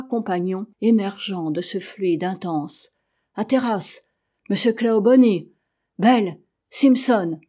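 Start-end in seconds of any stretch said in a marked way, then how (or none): 3.3-7.4 calling out. A narrator's reading. Climber spots his three companions above the fog, calling out. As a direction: call the companions' names, louder as they go. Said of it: "Ces quatre cris".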